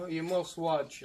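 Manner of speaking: ordinary delivery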